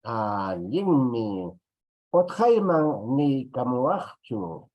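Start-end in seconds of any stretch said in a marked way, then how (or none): 2.1-4.7 slow, deliberate, syllable by syllable; slow Quechua word for students; slow teaching demo. Shown as a demonstration. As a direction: spell it out slowly for the class. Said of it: "así vamos a escribirlo".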